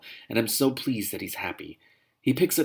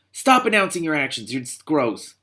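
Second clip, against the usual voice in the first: exasperated retort; 6 semitones higher, 5 dB louder than usual. Real, not acted. Reader mocks the book aloud.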